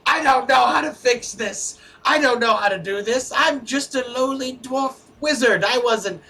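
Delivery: high, loud, frantic outburst; panicked shout. Acted. Dwarf wizard shouts in despair after tossing his scrolls. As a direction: throw up your hands and shout in despair. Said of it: "throws his map ... into the air and says".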